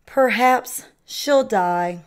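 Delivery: ordinary delivery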